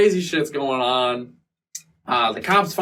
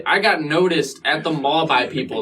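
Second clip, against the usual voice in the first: ordinary talk, nothing marked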